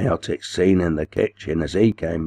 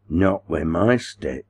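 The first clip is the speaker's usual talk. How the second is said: ordinary delivery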